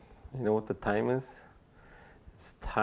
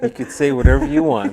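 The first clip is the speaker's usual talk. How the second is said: higher and louder through laughter; laughing; 10 semitones higher, 9 dB louder than usual. Real, not acted. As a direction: giggle out the whole line. Laughing at guest's dodge.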